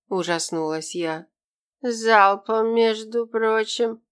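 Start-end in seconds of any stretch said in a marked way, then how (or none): 1.8-4.0 slurring, tongue tripping, slow; slurred drunk. Acted. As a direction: slur the words like a drunk. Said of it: "заплетающимся языком добавил". (заплетающимся языком)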